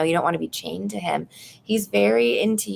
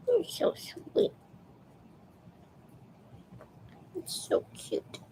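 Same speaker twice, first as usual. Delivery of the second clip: cooing whisper; 11 semitones higher, 9 dB quieter, slower than usual. Real, not acted. Talking to her cat Nuggies, whispered baby talk. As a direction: whisper sweet nothings to a cat.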